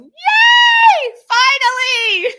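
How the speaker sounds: put-on high, loud, squealing child's cheer; shrieking with glee as a happy child; inner child. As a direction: burst out like an overjoyed little kid. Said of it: "my inner child inside of me going".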